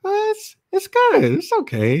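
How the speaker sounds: high, hesitant mock wife voice; imitating the wife. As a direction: play the unconvinced wife, hedging. Wife's lukewarm verdict.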